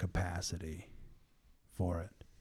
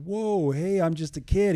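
put-on much higher and louder, a protesting kid; panicked young Jeremiah; 9 semitones higher, 7 dB louder than usual. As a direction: throw up your hands like a scared boy. Jeremiah protesting God's call.

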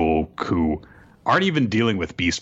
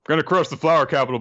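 high, fast villain boast; hammed-up Kaido; 8 semitones higher, much faster than usual. Kaido boasting about crushing the flower capital, high and fast. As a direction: rattle off the threat. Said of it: "Kaido says".

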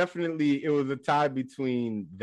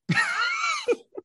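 mocking laugh. Mocking open-mouthed drivers.

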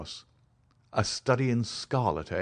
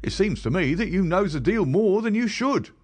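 higher, louder common London constable; a plain-spoken beat policeman telling his tale, pitched up; constable Rance; 11 semitones higher, 6 dB louder than usual. Acted. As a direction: policeman recounting what he found, higher and louder. Rance accuses Holmes.

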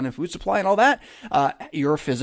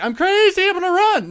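much higher, louder hammed-up frantic panic; mock panic; 4 dB louder than usual. Imagined person panicking before a bear, hammed-up high frantic squeal. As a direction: play a person losing it, squeal it out in fake alarm. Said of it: "You're like".

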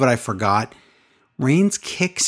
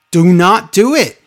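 louder, higher, each word stressed; emphatic retold plea; 5 semitones higher, 6 dB louder than usual. Brother begging him not to throw towel, emphatic. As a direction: plead firmly, raising pitch and volume.

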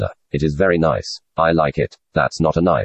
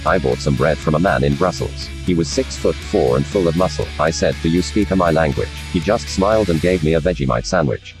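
broad, much lower hammed-up Australian accent; put-on Australian accent; 10 semitones lower than usual. Asked about the land down under, [name] answers in a hammed-up Australian accent. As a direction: play the stage Aussie, deep and broad. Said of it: "You don't sound like yourself".

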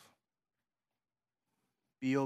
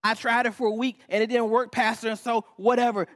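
higher, louder fed-up complaint; mock complaining member; 11 semitones higher, 9 dB louder than usual. Church member gives up to the pastor, mock fed-up. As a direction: whine it back as a quitter.

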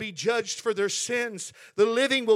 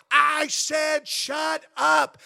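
screaming; 5 semitones higher, 5 dB louder, much slower than usual. Retold or imitated. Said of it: "he was screaming".

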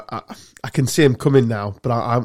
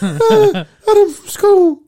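put-on very high, giggly, timid boy voice; nervous schoolboy; 6 dB louder, much slower than usual. Schoolboy answering the girl's dad, high and giggling. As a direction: squeak it out, laughing nervously.

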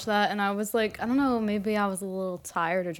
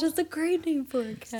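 usual speaking voice

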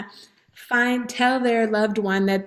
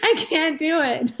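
higher, laughing through the words; laughing panic; 7 semitones higher than usual. Real, not acted. Telling Sherry about a late pregnancy, laughing and high. Laughing at her own fear.

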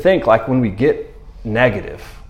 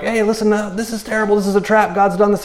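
fearful; 7 semitones higher than usual. Retold or imitated. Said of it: "making fearful announcements".

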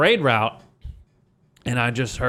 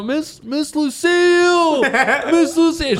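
high, slow calling out with a laugh; laughing call to a drunk teacher on a float; yelling her name; slower than usual. Retold or imitated. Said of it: "I was, like, yelling her name".

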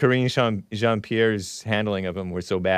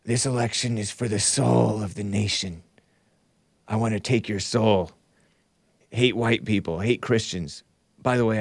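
Sleepy Joe impression. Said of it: "This is my Sleepy Joe impression".

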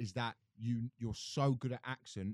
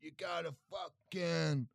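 Tom Stade; 4 semitones higher than usual. Acted. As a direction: stretch the key words like a rambling comedian. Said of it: "I could do Tom Stade".

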